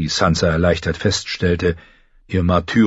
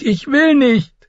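even higher, desperate refusal; rising panicked refusal; 3 dB louder, slower than usual. A narrator's reading. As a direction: refuse again near breaking. (noch höher, verzweifelte Weigerung)

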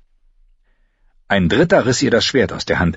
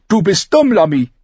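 high, loud angry retort; heated, high-pitched character voice; furious; 12 semitones higher, 5 dB louder than usual. A narrator's reading. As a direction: throw it back at him angrily. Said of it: "hielt ihm Arya wütend entgegen".

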